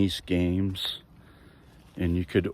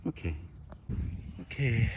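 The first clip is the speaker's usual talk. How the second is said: ordinary talk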